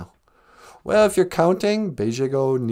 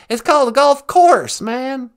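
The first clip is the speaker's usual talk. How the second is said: George W. Bush impression; 5 semitones higher, 4 dB louder than usual. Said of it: "George W. Bush".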